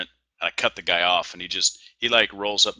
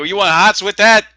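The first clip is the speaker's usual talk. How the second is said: much higher and louder Boston accent; Boston deli guy; 12 semitones higher, 12 dB louder than usual. Shown as a demonstration. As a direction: ham up the Boston counterman on request. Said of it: "How do they say it?".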